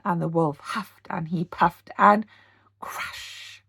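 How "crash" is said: ordinary delivery, nothing marked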